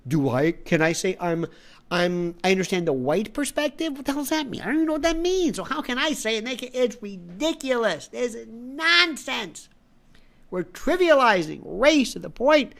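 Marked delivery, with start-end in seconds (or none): 3.9-9.7 pitched high in outraged disbelief; exasperated disbelief. Real, not acted. To the audience about race talk, high exasperated disbelief. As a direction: throw up your hands at the nonsense.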